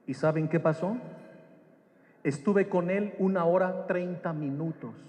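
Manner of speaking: ordinary delivery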